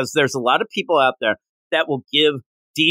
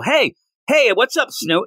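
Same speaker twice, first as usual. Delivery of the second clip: cheery greeting; 8 semitones higher, slower than usual. Retold or imitated. Superman greeting zoo creature.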